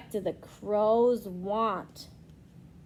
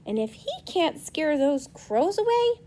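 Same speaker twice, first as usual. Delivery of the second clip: usual speaking voice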